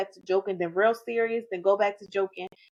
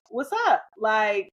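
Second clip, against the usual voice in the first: higher-pitched, puzzled retort to a stranger; wary challenge; 4 semitones higher, slower than usual. Retold or imitated. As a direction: act out questioning someone who hailed you. Retells challenging a stranger in yard.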